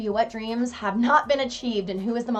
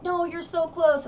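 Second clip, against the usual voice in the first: imitating encouragement; 9 semitones higher than usual. Quoting Chase's encouragement.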